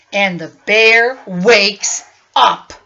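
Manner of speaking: booming angry storybook bear; growly bear reading. A narrator's reading. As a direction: roar the lines like an angry bear. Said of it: "gnarls and snarls".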